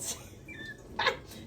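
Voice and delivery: very high airy giggle; high squeaky laugh. Real, not acted. Laughing at how the dog winds her up, squeaky giggle.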